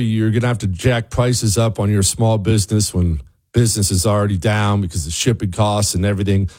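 ordinary delivery

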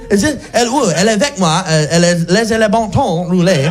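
confused French. Pretend. Lapses into broken French.

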